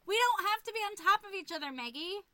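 high, fast, loud teasing; playful teasing. Real, not acted. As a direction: tease her into it, laughing. Teasing the okay-bye routine.

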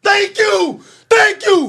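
loud thanks. Real, not acted. Loud thanks to Missus Stewart.

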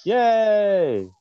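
loud high yay; excited cheer. Real, not acted. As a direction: cheer like a delighted fan. Cheering for limericks.

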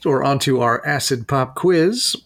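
ordinary delivery

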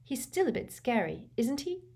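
put-on a timid, uneasy girl's voice; uneasy, seeking agreement; Hermione. A narrator's reading. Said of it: "said Hermione nervously".

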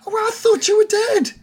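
very high, squealed reunion cries; high-pitched overjoyed character voice; jumping with joy. Acted. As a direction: exclaim it as if bouncing up and down. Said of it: "he's, like, jumping".

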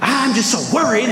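high, mock-anxious voice; worked-up worry. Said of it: "pacing around and getting everybody all worked up".

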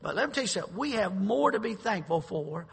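loud, building sermon delivery, slowing at the end; fiery preaching. Acted. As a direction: preach it hard to the congregation. Preaching at full force.

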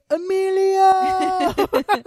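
loud, high club-guy call; Night at Roxbury impression. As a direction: belt out the name like a club bro. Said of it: "I remember the bit from Night at Roxbury".

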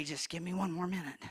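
low, soft preacher's wind-down; hushed testimony. Real, not acted. Preacher recalling going home to tell daddy, hushed testimony. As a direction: bring it right down, quietly, with long pauses.

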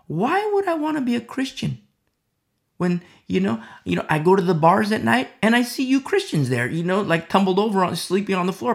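a scornful outsider's voice, pitch jumping up on the questions; hammed-up sneer at you Christians; mocking non-believer. Preacher voicing a scoffing non-believer to the congregation, mocking.

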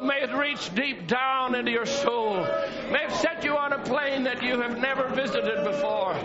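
usual speaking voice

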